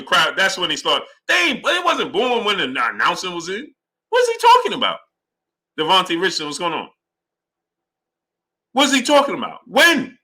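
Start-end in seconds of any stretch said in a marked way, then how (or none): 4.1-5.0 very high, baffled what's he talking about; can't believe the nonsense; squeaky mock disbelief; incredulous question. Real, not acted. Mocking the fighter's claim. 8.7-10.2 incredulous. Real, not acted. Repeats incredulity.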